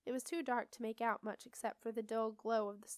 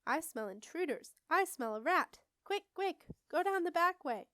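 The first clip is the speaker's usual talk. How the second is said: urgent cries building higher and louder; shouting; 7 semitones higher, 5 dB louder than usual. A narrator's reading. Witch warns the coven of intruders, shouting. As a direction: raise the alarm, climbing in pitch. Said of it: "Another voice shouted".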